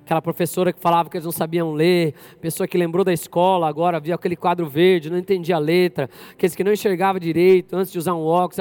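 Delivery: low, hushed prayer voice. Real, not acted. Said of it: "deixa eu orar primeiro".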